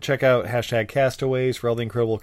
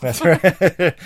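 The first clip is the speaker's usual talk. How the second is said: laughing; 5 semitones higher, 7 dB louder than usual. Real, not acted. Laughing at Grindr joke.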